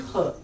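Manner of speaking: ख scraped at the back of the throat; throaty kh. Shown as a demonstration. Teacher repeating the letter for learners, throaty ख. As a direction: say ख as "kha" from the throat. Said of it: "इसकी आवाज भी हलक से आएगी".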